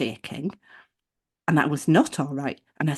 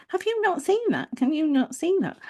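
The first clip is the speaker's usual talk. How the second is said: much higher mock nagging question; high-pitched, pointed repeat of a reproach; imitating a condescending receptionist; 11 semitones higher than usual. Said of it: "So it was her second".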